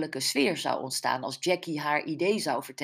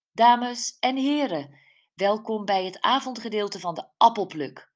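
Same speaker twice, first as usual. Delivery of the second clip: announcing to a crowd; 5 semitones higher, 4 dB louder than usual. Acted. Host opens the apple-picking evening to guests, announcing. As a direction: welcome the whole crowd, raised and ceremonious.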